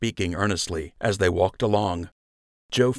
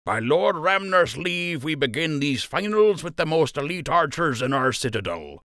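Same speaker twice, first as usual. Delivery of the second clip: formal announcer; 9 semitones higher than usual. Acted. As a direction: proclaim to the crowd. Official opens the finals.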